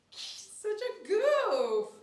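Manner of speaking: high, drawn-out fond teasing; teasing the dog. Real, not acted. Groomer teases the clumsy dog, high and slow. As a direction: stretch it out, laughing at the silly pup.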